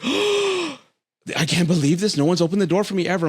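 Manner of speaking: high gasping mock voice, awestruck; imitating amazed date. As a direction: sound floored that someone opened a door. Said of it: "She's like, gasps".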